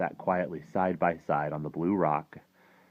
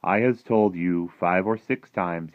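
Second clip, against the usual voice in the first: firm; 2 semitones higher, 9 dB louder, slower than usual. A narrator's reading. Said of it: "sighed and shook his head firmly".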